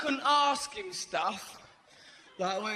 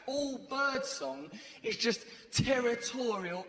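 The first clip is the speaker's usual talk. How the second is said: usual speaking voice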